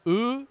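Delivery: E as a rounded "eu", drawn out and rising; long, high rounded eu on E; unaccented French e. Shown as a demonstration. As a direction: round the lips for E, like "euh". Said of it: "c'est je remis".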